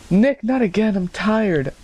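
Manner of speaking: high, whining tired complaint; mock girlfriend protest. Voices woman protesting to boyfriend.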